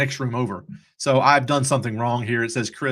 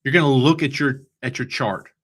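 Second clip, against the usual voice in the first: ordinary talk, nothing marked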